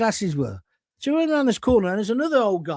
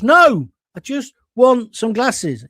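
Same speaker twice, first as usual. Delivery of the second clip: exasperated; 4 semitones higher, 7 dB louder, much slower than usual. Retold or imitated. Exasperated customer in retold sketch.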